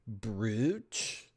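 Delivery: ordinary talk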